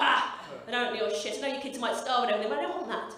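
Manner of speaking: ordinary talk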